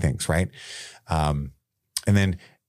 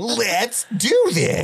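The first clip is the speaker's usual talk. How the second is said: very high, very slow toy voice; Leonard squeak; 13 semitones higher, much slower than usual. Pretend. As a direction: drag it out squeaky and eager. Voicing Leonard flying plane.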